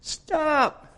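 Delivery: high-pitched, restrained mock shriek; mock scream. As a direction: imitate a screaming mother without the volume. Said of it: "the mother screams".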